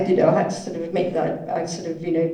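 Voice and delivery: ordinary talk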